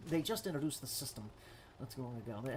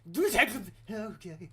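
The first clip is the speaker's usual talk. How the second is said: high, loud imitated bird call; turning bird call; 5 semitones higher, 6 dB louder than usual. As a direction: make silly bird noises for a turn. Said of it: "my turning bird call".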